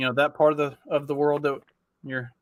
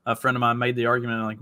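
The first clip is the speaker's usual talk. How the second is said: ordinary talk, nothing marked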